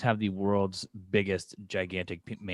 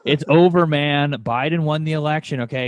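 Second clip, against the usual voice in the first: mock indignant; 6 semitones higher, 10 dB louder than usual. Mock insistence covid ended.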